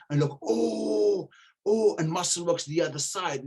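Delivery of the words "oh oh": high, loud hooting; imitated crowd jeer. Rival gym's crowd at the show, imitated jeering. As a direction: hoot it out like a rowdy crowd.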